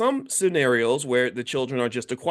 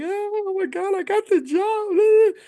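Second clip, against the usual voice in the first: very high, slow, squealing delight; thrilled squeal; 4 dB louder, slower than usual. Retold or imitated. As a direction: squeal like you just got the offer. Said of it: "We're so excited".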